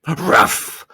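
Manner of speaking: gruff barked Ruff; dog bark. Acted. Watchdog barking at Milo, dog bark. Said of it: "exclaimed the watchdog".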